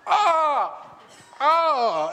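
high loud startled cry; mock pained yelp. Dad hit in the eye at night yelps loudly. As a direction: yelp like you just got hit.

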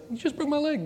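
high-pitched mock disbelief; incredulous protest. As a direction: object in a squeaky, comic voice. Mocks the rival's sudden reconciliation.

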